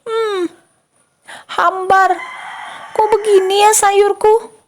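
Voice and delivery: high-pitched mock dismay of a mother; mama tasting food. Mother tastes her unsalted vegetables, high-pitched mock dismay. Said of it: "bagaimana ekspresi mama kita waktu merasainnya".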